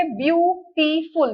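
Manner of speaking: Beautiful drawn out slowly to count syllables; teacher splitting the word into syllables. Shown as a demonstration. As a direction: break beautiful into its beats. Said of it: "इसके syllable check करेंगे".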